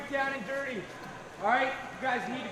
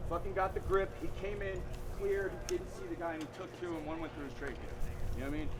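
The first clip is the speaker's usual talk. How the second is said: hushed, grave; 5 semitones lower, 8 dB quieter than usual. Real, not acted. Recounting a soldier's death.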